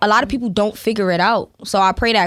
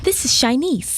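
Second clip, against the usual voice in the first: Siri voice; 4 semitones higher than usual. Shown as a demonstration. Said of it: "the Siri voice".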